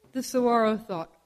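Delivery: ordinary delivery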